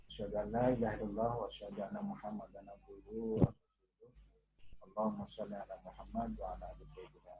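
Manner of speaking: soft, low Arabic opening prayer; prayer recitation. Acted.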